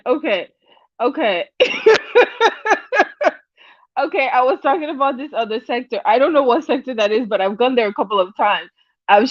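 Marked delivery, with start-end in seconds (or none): 1.5-3.3 a high burst of giggles; high laughter. Real, not acted. Host cracks up again over the beta man topic, high giggles. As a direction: crack up once more.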